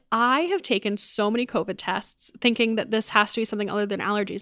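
normal talking voice

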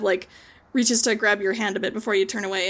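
ordinary delivery

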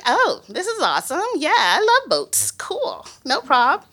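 high, overeager forced cheer; bright put-on delight hiding panic; fake enthusiasm. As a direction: pretend to love it while dreading it. Feigning excitement about the boat trip.

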